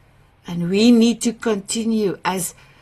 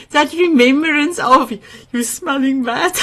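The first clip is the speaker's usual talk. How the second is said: normal talking voice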